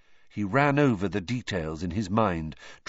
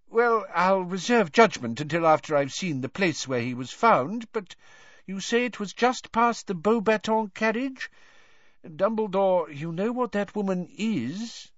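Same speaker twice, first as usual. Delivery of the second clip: 1 Fudge minister; 11 semitones higher than usual. A narrator's reading. Fudge character voice.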